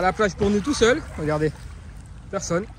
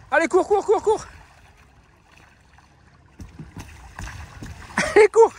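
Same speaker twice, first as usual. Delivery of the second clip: much higher, building to a laughing squeal; high cheering; 13 semitones higher than usual. Real, not acted. Owner cheering her hamster on to run, high excited calls. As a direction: cheer the little runner on, rising in pitch.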